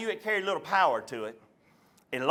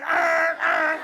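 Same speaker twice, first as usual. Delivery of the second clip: voiced truck horn blasts, louder; mock truck horn; 6 semitones higher, 3 dB louder than usual. Truck drivers honking at a roadside whipping, mock horn. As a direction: honk like a passing truck. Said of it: "blowing their horn".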